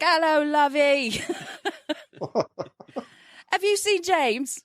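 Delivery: sweet London lady, drawn out; mock London accent, slow and sweet. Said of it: "she was from London".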